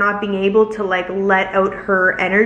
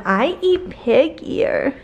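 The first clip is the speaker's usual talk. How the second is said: high, very slow put-on puppy voice; dog's voice; 5 semitones higher, much slower than usual. Owner voicing her dog to viewers in a slow puppy voice. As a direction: speak for the dog, sweetly. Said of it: "Tell them".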